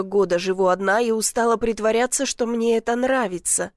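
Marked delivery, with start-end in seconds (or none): none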